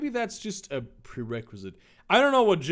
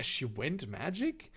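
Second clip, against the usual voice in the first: ordinary delivery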